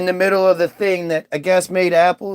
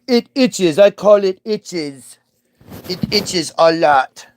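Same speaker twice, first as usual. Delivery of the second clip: dim Eve; slower than usual. Retold or imitated. Eve voiced complaining of itch.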